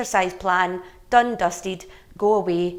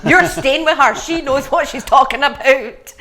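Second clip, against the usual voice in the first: loud, high, firm mum voice; firm mother; 6 semitones higher, 11 dB louder than usual. Retold or imitated. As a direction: insist loudly like a strict mum. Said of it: "And Louise was like".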